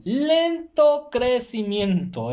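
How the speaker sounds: drawn-out emphasis. Real, not acted. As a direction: draw out each syllable of "lento crecimiento" to stress the slowness. Repeats the phrase, drawn out for emphasis. ("lento crecimiento" muy lento y alargado, con énfasis)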